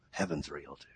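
very low, hushed wonder; awed thought. Retold or imitated. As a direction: sink to a quiet murmur of realization. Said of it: "I think".

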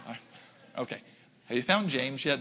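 normal talking voice

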